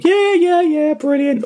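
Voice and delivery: very high, loud, gushing agreement; mock-enthusiastic squeal of agreement; eager guest imitation. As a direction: pitch it way up, happy to oblige. Acting friendly guest answering fans.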